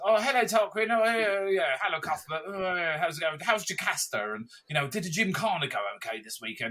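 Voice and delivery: hammed-up toff small talk; posh young guys. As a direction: send up the posh lads in front. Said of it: "these young guys in front of us".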